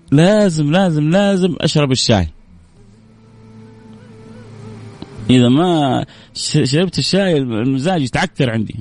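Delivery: hammed-up Hadhrami artist voice; artist's answer. Host retelling the artist's answer, high and hammed-up.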